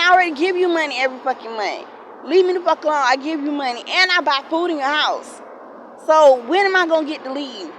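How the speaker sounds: high, loud furious retort; shouting at the aunt over money; angry yelling. Retold or imitated. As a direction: yell it back at her, fed up. Retold fight with aunt.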